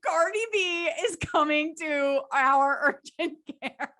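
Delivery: laughing through the line, slowly; slow, giddy line broken by a real laugh; laughing while quoting. Retold or imitated. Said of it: "I lose it".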